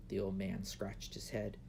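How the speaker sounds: usual speaking voice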